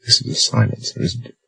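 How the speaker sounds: hushed whisper; frightened whispered question. A narrator's reading. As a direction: breathe it, afraid to be heard. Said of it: "She whispered".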